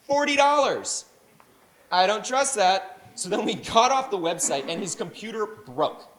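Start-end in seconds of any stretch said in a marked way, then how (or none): 0.1-3.0 shocked. Retold or imitated. Acted outraged reaction to price.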